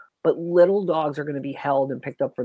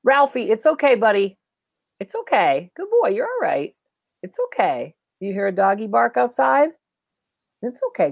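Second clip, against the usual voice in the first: high, gentle reassurance to a spooked dog; soothing a scared dog; 7 semitones higher than usual. Real, not acted. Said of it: "I jolly talked him".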